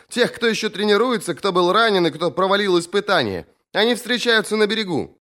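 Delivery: high-pitched female character voice; insistent sister's high voice; sister relaying news. A narrator's reading. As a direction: report the summons, pitch held high.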